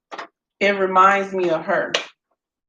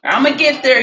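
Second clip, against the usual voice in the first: louder, higher, rallying the audience; I'ma get there, pitched up and pushed louder; hyped promise; 5 semitones higher, 4 dB louder than usual. Real, not acted. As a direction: call it out to y'all with a push.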